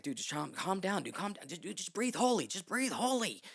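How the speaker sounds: retold exasperated "calm down"; urgent calming. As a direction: loudly talk down a panicking friend. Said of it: "And he's like".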